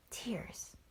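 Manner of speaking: whispered softly. A narrator's reading. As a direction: breathe the word gently. Bagheera gently explains tears.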